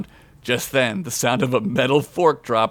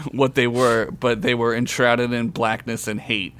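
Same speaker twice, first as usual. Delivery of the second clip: usual speaking voice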